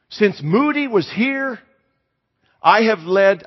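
high, emphatic, acted elation; joyful testimony. Church lady telling her pastor the news, elated. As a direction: announce the news with hammed-up excitement.

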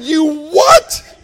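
loud, high incredulous yell; outraged disbelief. Retold or imitated. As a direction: explode in comic outrage. Outraged at toe being buried.

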